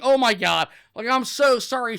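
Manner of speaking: high, loud flustered outburst; frantic apology. Retold or imitated. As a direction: apologise in a comic panic. Retold frantic apology to startled woman.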